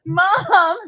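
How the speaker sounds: high voice calling for parents; mock childish waking. Patient waking up after CPR, high mock whimper. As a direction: play the revived patient, high and helpless.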